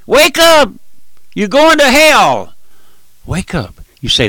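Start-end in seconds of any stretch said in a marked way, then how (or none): 0.1-2.6 fiery shout. Retold or imitated. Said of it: "if you hear in the old Trailblazer". 3.2-3.8 softer plea. Retold or imitated. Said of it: "if you hear in the old Trailblazer".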